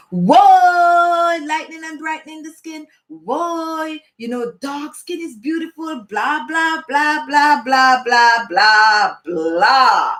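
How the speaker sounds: mock-scandalized critics running into blah-blah; mocking bad feedback. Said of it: "really bad feedback like".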